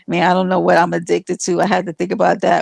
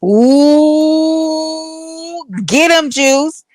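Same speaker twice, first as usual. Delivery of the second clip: excited cheer; 10 semitones higher, 5 dB louder, much slower than usual. Real, not acted. Cheering on a friend in chat.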